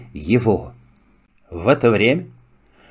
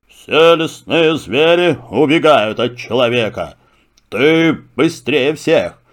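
lion voice. Acted. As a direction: speak as a calm king of beasts. Lion speaks. (голос льва)

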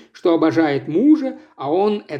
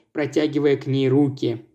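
plain reading voice

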